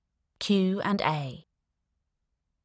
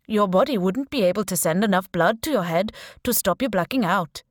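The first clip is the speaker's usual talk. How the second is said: put-on Indian accent; 3 dB louder than usual. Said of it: "he said in a strong Indian accent".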